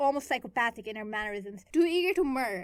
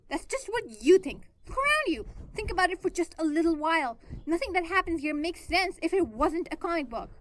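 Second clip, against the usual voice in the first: insistent near-shout; 7 semitones higher, 6 dB louder than usual. A narrator's reading. Gwen insists, almost shouting.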